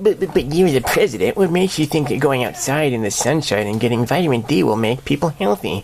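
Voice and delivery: hammed-up whiny heckler; mock mocking reporter. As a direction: needle the president in a whiny voice. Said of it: "I just said".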